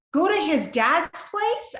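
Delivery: high, loud, slow disbelief; stunned, can't believe the suggestion; incredulous. Retold or imitated. Woman reacting to advice to visit husband, incredulous. Incredulous reply to pastor's wife.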